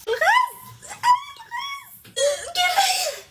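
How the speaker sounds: very high, loud drawn-out shriek; hammed-up panic. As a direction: shriek an alarm in mock panic. Mock panic over the stove. (صريخ هلع تمثيلي)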